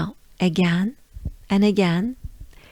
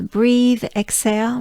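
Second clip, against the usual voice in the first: normal talking voice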